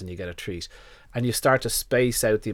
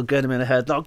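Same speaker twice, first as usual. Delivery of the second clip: ordinary talk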